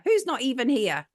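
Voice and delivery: usual speaking voice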